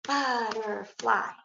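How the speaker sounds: Butterfly in slow, separate syllables; chopped into syllables. Shown as a demonstration. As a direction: chop Butterfly slowly into but-ter-fly. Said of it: "chop the syllables".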